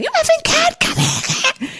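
very high mock yell fading to a hissed come here, cat; retold scream. Groom in a tux chasing the cat in snow, mock yelling.

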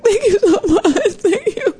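high, tearful laugh of relief; overjoyed, almost sobbing with relief; laughing and crying. Retold or imitated. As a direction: thank her through laughter and tears. Relief, laughing through tears.

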